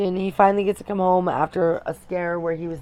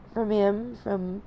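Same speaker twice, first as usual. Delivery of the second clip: holding back tears; 3 semitones higher, 7 dB quieter than usual. Real, not acted. New mom confessing postpartum struggles to viewers, close to tears. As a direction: voice straining as tears come, long pauses.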